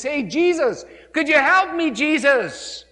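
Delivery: loud, pleading call across the road; calling out for help. Retold or imitated. Blind man by the road calling loudly to Jesus. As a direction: shout to be heard over the crowd.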